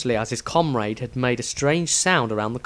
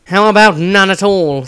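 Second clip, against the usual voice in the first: Dieter's voice; 7 semitones higher, 9 dB louder than usual. A narrator's reading. Dieter voiced, dry retort.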